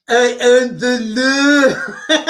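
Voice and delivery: slow drawn-out Stallone catchphrase, laughing; lightly voiced Stallone; Stallone impression. Tough guy to viewers, slow laughing Stallone line. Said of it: "my Sly Stallone impression".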